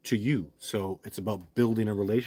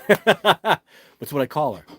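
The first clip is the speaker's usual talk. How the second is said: laughing through the words, louder and slower; 5 semitones higher, 10 dB louder, much slower than usual. Real, not acted. To his viewers about the nickname, laughing.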